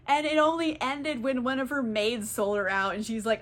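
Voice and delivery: ordinary delivery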